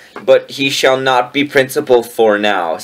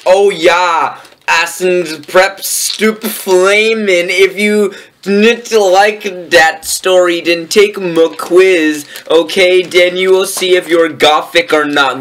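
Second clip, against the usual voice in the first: theatrical author's note; 6 semitones higher than usual. Acted. Reader performs the author's note to readers, theatrical declamation. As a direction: proclaim it like a hammed-up challenge.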